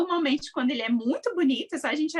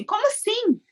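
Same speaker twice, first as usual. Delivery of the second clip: higher-pitched indignant question; wife freaking out; 9 semitones higher than usual. Acted. Wife reacting to talk of another child, indignant. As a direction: act out an incredulous wife's protest. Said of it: "ela vai surtar".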